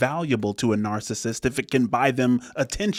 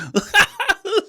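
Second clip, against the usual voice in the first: put-on very high squealing child's giggle; laughing-crying giggle; 5 dB louder than usual. Dad imitating daughter caught between laughing and crying, high squeal. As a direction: squeal a torn half-laugh, half-cry. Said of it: "She's like".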